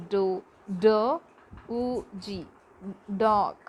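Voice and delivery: "dog" split into separate sounds, then said whole; sounding out. Shown as a demonstration. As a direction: break "dog" into its sounds, pausing between each. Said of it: "made of three sounds put together".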